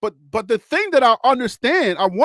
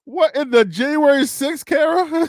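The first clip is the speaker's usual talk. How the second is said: higher, louder, half-laughing disbelief; incredulous; 6 semitones higher, 4 dB louder than usual. Real, not acted. To Kara, mocking her riot claim: incredulous, half-laughing. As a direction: squeak it out in amused disbelief.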